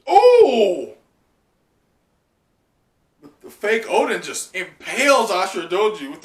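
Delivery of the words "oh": very loud, very high real shock; shocked gasp. Real, not acted. Reacting live to a shocking panel, loud shock. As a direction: blurt it out stunned.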